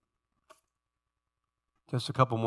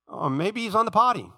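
cheeky, lilting mock guess; playfully crude jeering; mocking taunt; 7 semitones higher than usual. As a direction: taunt them with a crude little suggestion. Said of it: "He starts mocking them".

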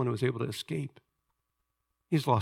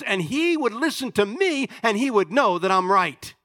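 loud, high, indignant protest; impassioned outcry of a wronged man; angry Job; 11 semitones higher, 8 dB louder than usual. Acted. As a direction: plead your innocence at full force, raging at God. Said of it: "he starts to become angry".

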